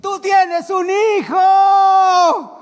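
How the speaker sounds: high, slow, loud shout; ecstatic shout. Acted. Preacher shouts Abraham's discovery to the congregation, slow and loud. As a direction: cry it out to the church in revelation.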